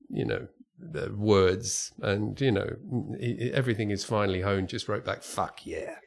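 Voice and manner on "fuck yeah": near-whispered, punchy and gleeful; hushed blunt reply. Retold or imitated. Neil answering Dirk's email, a hushed delighted curse. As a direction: deliver it like a delighted secret.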